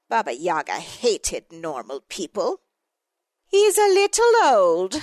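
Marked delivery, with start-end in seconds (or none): 3.5-4.9 high, loud icy queen complaining; complaining Snow Queen. A narrator's reading. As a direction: whine it like a haughty ice queen. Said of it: "the Snow Queen complained".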